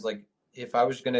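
ordinary talk, nothing marked

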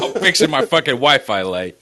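playful exasperated comeback through laughter; laughing retort. Real, not acted. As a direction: fire it back while cracking up. Defending story amid laughter.

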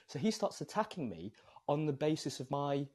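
usual speaking voice

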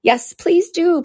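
high, drawn-out plea; slow, high-pitched entreaty; pleading. Acted. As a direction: beg eagerly, stretching the words. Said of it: "pleaded Alice".

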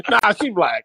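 said through a laugh; laughing quip. Acted. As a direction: toss it off while laughing. Joking quip between friends.

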